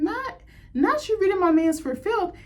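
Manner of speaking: shrieked in a high, slow voice; high excited squeal. Real, not acted. Fan gasping at the villain's insult, high and shrill. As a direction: drag the words out in a high shriek of shock. Reacting to the show.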